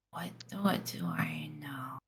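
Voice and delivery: slow, hushed captive's question; weak, dazed whisper. Pretend. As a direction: whisper it haltingly, as if just hit. Dazed captive after head slam.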